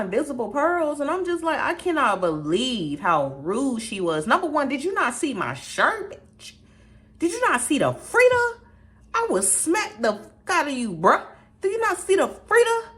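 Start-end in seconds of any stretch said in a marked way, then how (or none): 4.5-11.2 high and loud, fired up; outraged high shouting rant. Real, not acted. Outraged rant about rude manager. 11.6-12.9 high and loud, fired up; outraged high shouting rant. Real, not acted. Repeated outraged question.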